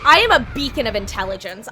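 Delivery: loud sarcastic self-mockery; mock herself loudly. Real, not acted. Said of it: "repeats the line in another voice".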